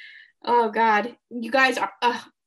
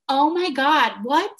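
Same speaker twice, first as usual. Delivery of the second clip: higher, louder gasp of disbelief; retold shock; 5 semitones higher, 7 dB louder, slower than usual. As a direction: replay your stunned reaction, voice jumping up. Retells own shocked reaction to news.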